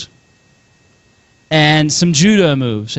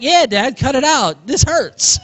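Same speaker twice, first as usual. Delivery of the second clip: lightly voiced protesting child, pitched up; complaining kid; 7 semitones higher, slower than usual. Boy pinned by dad backing up mom, mock complaint. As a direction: plead in a higher kid voice, half laughing.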